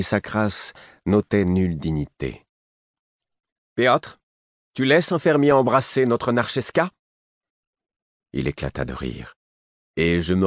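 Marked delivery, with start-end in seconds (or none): 3.8-6.9 high, loud, haughty outrage; scandalised exclamation, pitched high; imperious, scandalised. A narrator's reading. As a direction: demand an answer like an affronted noblewoman. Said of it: "elle s'exclama d'un ton impérieux".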